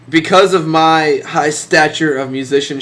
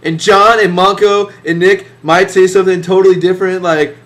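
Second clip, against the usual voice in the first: ordinary talk, nothing marked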